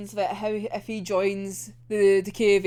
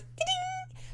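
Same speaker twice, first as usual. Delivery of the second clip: sparkle sound; 10 dB quieter than usual. Retold or imitated. Sound of magic stars.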